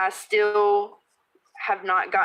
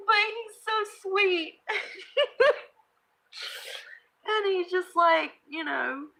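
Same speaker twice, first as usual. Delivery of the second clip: high, giggling praise; fond laughing; 8 semitones higher than usual. Real, not acted. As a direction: gush about him between laughs. Gushing about son while laughing.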